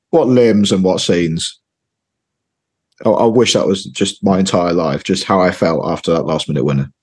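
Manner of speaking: usual speaking voice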